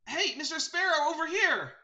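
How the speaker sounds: high, loud character call. Acted. Birdie calling Mister Sparrow over, high and eager. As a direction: hail someone across a clearing.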